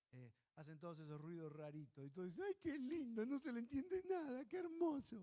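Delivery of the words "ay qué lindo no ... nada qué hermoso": cooing adults. Retold or imitated. Adults cooing over a baby.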